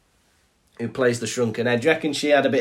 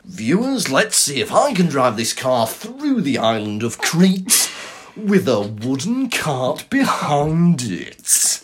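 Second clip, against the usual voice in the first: slow, stretched-out presenter cadence; mock car-show presenter; slower than usual. Host setting viewers a silly challenge, hammy presenter voice. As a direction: draw out the end for suspense.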